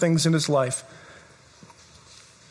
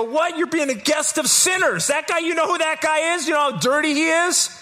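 higher, incredulous accusing crowd; scandalised onlookers, pitched up; critics objecting; 8 semitones higher than usual. Retold or imitated. Critics scolding Jesus about Zacchaeus, incredulous higher. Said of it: "They go".

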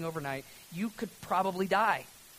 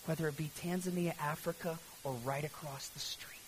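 soft, low sermon close, near a whisper at times; hushed preaching; 4 semitones lower, 9 dB quieter than usual. Real, not acted. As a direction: let the sermon settle into quiet conviction.

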